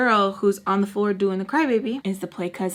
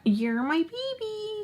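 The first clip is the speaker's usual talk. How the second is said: much higher, soft, slow cooing; drawn-out teasing coo; baby talk; 13 semitones higher, 5 dB quieter, slower than usual. Retold or imitated. Mocking the ex before his friends, slow baby talk. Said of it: "laughed at him when I said that".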